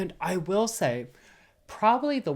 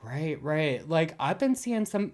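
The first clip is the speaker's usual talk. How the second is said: normal talking voice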